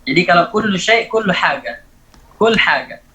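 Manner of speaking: ordinary delivery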